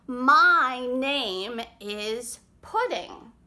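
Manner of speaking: very slow, drawn out; slow snail. Acted. Snail slowly introducing herself to the forest animals. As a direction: stretch each word like a slow snail.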